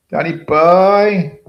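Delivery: long, loud, high sing-song call of the name; drawn-out call. Real, not acted. Greeting a viewer by calling out to him loudly. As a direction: stretch the name out like calling across a pub.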